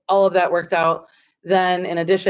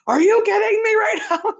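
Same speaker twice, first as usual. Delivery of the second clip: very high, thrilled mock squeal; imitating excited disbelief. Retelling the trades teacher's reaction on the phone, squealing with excitement. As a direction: squeal it in delighted disbelief, laugh breaking in. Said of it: "So she was so excited".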